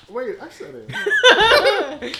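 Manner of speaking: laughing. Real, not acted. Laughing realization of joke.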